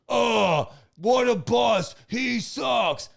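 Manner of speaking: loud, high mocking outburst; mock fan shout. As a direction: yell it like a disappointed fan. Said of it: "Everyone would be like".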